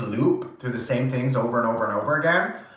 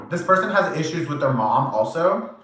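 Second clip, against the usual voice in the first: usual speaking voice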